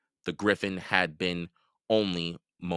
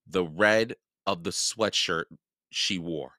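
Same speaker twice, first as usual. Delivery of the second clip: usual speaking voice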